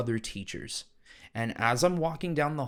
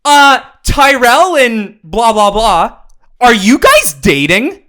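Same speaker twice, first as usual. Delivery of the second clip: loud, higher, slow mock-teacher shout; mock teacher blurt; 10 semitones higher, 19 dB louder, slower than usual. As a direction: announce it to the whole class. Teacher blurts question to class.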